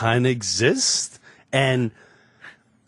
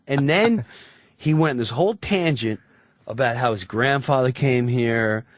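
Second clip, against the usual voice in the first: ordinary talk, nothing marked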